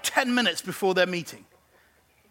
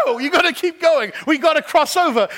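urging shout; 7 semitones higher, 7 dB louder than usual. Acted. Urging people onward.

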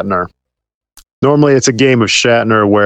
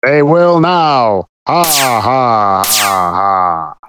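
giant alien booming voice; 3 semitones lower, much slower than usual. Acted. Villain threat and laugh.

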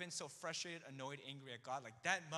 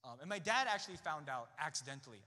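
usual speaking voice

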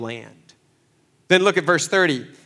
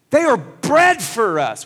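"bread" hit higher and louder, slower; bold preaching; 6 semitones higher, 6 dB louder than usual. Retold or imitated. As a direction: preach "They are bread for us" with full conviction. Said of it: "He says".

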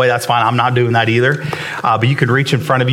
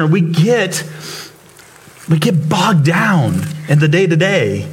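impassioned preaching; 6 semitones higher than usual. Acted. Pastor praising God to the congregation, fervent and raised. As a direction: lift them up with wonder at God's grace. Preaching at full force, music under.

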